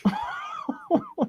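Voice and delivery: wild laugh. Real, not acted. Laughs into new mic.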